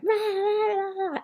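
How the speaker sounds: high, loud imitated raging "rah"; mock rage roar. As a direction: roar like a drunk raging dad. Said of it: "if my dad is going".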